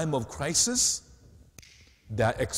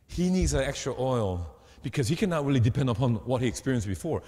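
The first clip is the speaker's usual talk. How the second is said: normal talking voice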